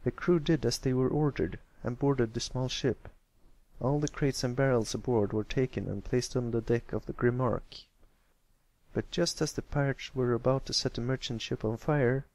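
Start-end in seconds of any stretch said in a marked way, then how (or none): none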